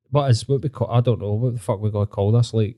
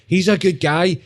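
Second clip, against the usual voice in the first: mock gullible fan; 6 semitones higher, slower than usual.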